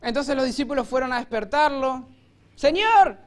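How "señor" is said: much higher, loud desperate cry of "Señor"; panicked shout. Retold or imitated. Disciples shouting to wake Jesus in the storm. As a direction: yell for help over the storm. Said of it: "le gritaron".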